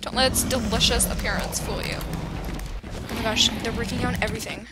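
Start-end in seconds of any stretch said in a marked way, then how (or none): 2.0-3.5 breathy awe. Real, not acted. Awed slow breath.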